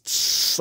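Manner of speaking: long unvoiced S hiss; sustained "sss" noise; harsh sibilant. Shown as a demonstration. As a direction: imitate piercing high-frequency sibilance. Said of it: "that can be very harsh sounding".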